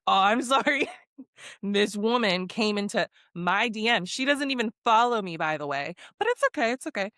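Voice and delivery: annoyed. Retold or imitated. Influencer forced into an apology video, sounding annoyed. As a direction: imitate a grudging, irritated non-apology. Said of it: "because he sounded annoyed".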